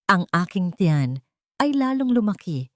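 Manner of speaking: usual speaking voice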